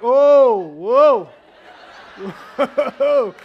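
loud, high, amazed whoa with a laugh; stunned whoa. Retold or imitated. Pastor retelling his reaction to the map, loud astonished whoa. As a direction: blown away by where the town is. Shocked on googling small town.

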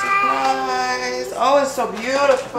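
high, bright gasp of delight at a gift; delighted surprise. Real, not acted. Mother gushing to her daughter over a drawing, delighted. Mom reacts to child's drawing.

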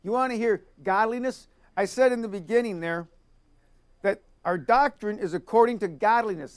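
usual speaking voice

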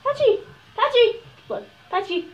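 high, loud call to the dog. Real, not acted. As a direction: call out the dog's name eagerly. Calling the dog to look.

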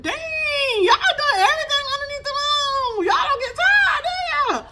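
high, loud, laughing call at the spirits; laughing shout. Real, not acted. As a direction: shout in amused disbelief.

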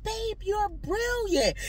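high, soft, soothing flattery; placating apology. Retold or imitated. Wife reassuring partner who felt called dumb, soothingly. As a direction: coo it gently to pacify.

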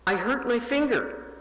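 put-on a hurt little boy crying out into the phone, understated; wailing. A narrator's reading. Little Paul tells the operator his finger hurts, a touch of a wail. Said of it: "Paul wailed into the phone".

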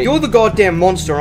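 normal talking voice

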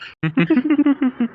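high laugh, a little removed in tone; knowing laugh after a guess. Real, not acted. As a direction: chuckle at your own remark. Laughs after uncertain prediction.

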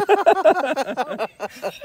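loud, high laughing fit; real helpless laughter, high and loud; uncontrolled laughing. Real, not acted. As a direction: crack up loudly. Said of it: "इतना हंसता है".

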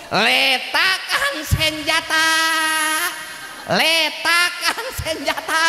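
put-on drawn-out Medan-accented military command; slow Medan order with every E a clear eh. Medan soldier orders his Sundanese troops to lay down arms, Medan accent. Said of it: "Tentara itu orang Medan".

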